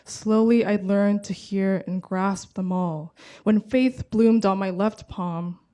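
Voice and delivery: measured poem recital with deliberate pauses; poetry reading. Acted. Translator reads a poem to the audience, measured poetry reading. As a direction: read it line by line, letting each phrase land.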